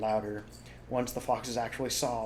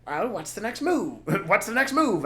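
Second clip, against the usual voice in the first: dwarf Bunce asking; 10 semitones higher, 6 dB louder than usual. Acted. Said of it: "asked Bunce".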